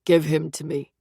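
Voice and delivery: low, hollow voice of a dream figure; a low, otherworldly command; low eerie demand. A narrator's reading.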